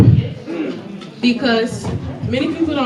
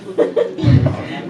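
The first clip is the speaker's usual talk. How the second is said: breathy burst of laughter; real giggling laugh; 4 semitones higher, 3 dB quieter than usual. Real, not acted. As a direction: break into a laugh mid-answer. Contestant laughs mid-answer.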